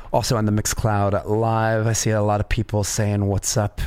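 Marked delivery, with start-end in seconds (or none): none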